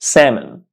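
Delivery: "salmon" as SAM-un; correct pronunciation. Shown as a demonstration. Teacher confirming the word, "salmon" with silent L. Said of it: "This word is pronounced".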